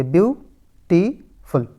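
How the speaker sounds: ब्यूटीफुल very slowly, split as ब्यू, टी, फुल; syllable by syllable. Shown as a demonstration. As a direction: count out ब्यूटीफुल in three spaced beats: ब्यू... टी... फुल. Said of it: "इसमें तीन syllables है total". (ब्यूटीफुल को धीरे-धीरे तीन हिस्सों में: ब्यू, टी, फुल)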